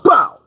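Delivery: loud, high mouth-made bow; crash impact noise. Retold or imitated. Retelling the crash, a loud imitated bow of impact. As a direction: punch out the sound of hitting a tree. Truck smacking trees in crash.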